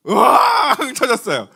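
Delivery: mock wailing. Pastor imitating his son bursting into tears, mock wail. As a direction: ham up a child's bawling.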